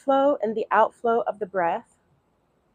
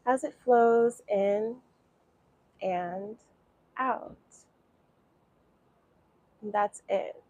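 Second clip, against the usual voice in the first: guided meditation. Acted. Teacher guiding beginners through breathing, calm meditation voice. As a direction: ease them into watching the breath.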